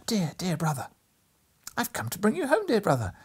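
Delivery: put-on high, bright child's voice, eager; little girl voice. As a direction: play a small excited girl. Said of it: "addressed him as her".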